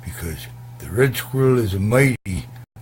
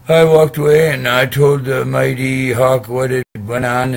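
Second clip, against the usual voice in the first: loud triumphant telling; 5 semitones higher, 10 dB louder than usual. Real, not acted.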